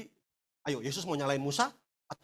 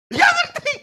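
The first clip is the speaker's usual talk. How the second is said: high, loud snapped reply; shouted reply; 14 dB louder, slower than usual. Retold or imitated. The partner shouts back during the quarrel, high and loud. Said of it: "Pasti kan keras".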